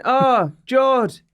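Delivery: put-on high, loud Chilean accent greeting. The fan spotting him at the gym, high Chilean accent. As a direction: call out the name in a Chilean accent. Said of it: "I won't do my accent".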